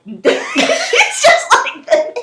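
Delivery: high, helpless giggly delivery; laughing. Real, not acted. Friend showing the winner's stunned reaction, laughing. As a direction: crack up while getting the words out.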